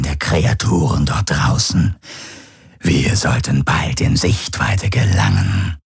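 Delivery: langsame, tiefe, raunende Wesensstimme; fremdartiges Wesen. Acted. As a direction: jedes Wort schwer und bedächtig setzen. Gaurus spricht langsam, fremd. (Gaurus raunt langsam und tief seinen Gefährten zu)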